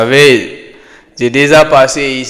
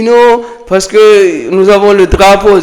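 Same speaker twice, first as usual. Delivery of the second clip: quoted objection, pitched higher; retold protest; 7 semitones higher than usual. Friend cites the flag to prove independence, imitated higher-pitched protest.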